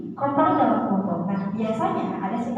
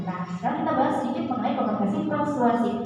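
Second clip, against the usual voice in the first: ordinary talk, nothing marked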